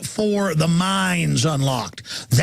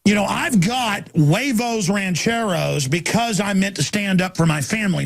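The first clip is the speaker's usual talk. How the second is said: normal talking voice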